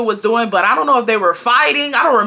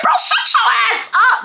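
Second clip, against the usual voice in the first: mock angry outburst; 12 semitones higher than usual. Narrator's angry retort.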